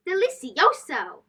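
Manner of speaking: ordinary delivery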